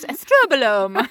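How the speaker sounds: slow posh British Estrobolome; Queen's English. Shown as a demonstration. Host names the Queen's English, then says Estrobolome slowly in a British accent. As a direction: stretch out "Estrobolome" in a lightly put-on British accent. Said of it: "The Queen's English".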